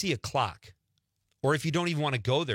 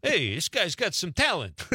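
bad hitman mafia voice; 5 semitones higher than usual. Host imitating an impressed hitman watching his victim, bad mafia voice. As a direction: play a hammy mafia hitman. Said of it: "That's my bad hitman mafia voice".

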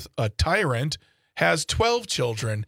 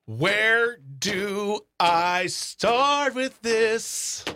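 exasperated; 6 semitones higher, much slower than usual. Real, not acted. Exasperated reaction.

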